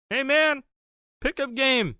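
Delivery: put-on high, eager kid calling out; neighborhood kid voice. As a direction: call him out to play like a kid. Kid calling him to play.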